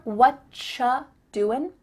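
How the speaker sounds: slow "what cha" with clear ch; drawn-out "wut-chuh doin"; ch sound. Shown as a demonstration. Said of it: "a ch sound".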